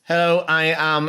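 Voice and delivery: ordinary talk, nothing marked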